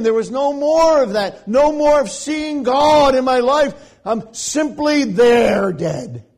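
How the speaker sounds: loud, high, impassioned preaching; fiery sermon climax; preaching at full force. Acted. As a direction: drive it home from the pulpit.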